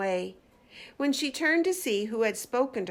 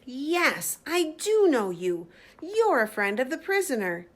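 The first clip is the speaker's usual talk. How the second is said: exclaiming maid; 4 semitones higher, 4 dB louder than usual. Acted. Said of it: "clapped her hands and exclaimed".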